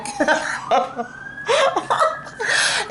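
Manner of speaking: high burst of real laughter. Real, not acted. Laughing at herself over the tree saga, real high laugh.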